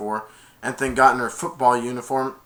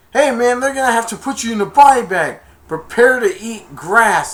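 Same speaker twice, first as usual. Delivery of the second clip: trash-talk shout; 9 semitones higher, 9 dB louder than usual. Retold or imitated. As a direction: drag out the threat, loud. Opponents trash-talking.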